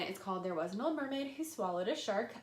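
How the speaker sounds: ordinary talk, nothing marked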